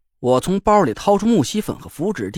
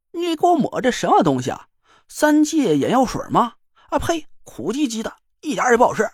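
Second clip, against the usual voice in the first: high, griping clown; whiny joker voice; 6 semitones higher than usual. Acted. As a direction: spit and whine at the taste. Guo Yongzhe voiced, complaining.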